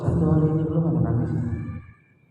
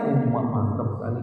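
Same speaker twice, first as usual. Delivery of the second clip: mock lustful man; 7 semitones lower, 3 dB quieter than usual. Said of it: "Bukan nafsunya yang naik".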